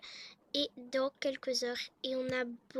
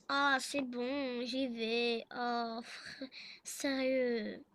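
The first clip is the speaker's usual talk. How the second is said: slow, sighing husband giving in; grudging compliance; 3 semitones lower, much slower than usual. Acted. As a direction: drag the words out, fed up. (soupirant, à contrecœur, traînant)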